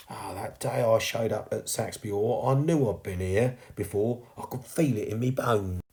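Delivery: earnest, dawning realisation as the sweep; chimney sweep ghost. Acted. Sweep tells girl he knew the Hall, realising. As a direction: sweep piecing it together, pitch lifted.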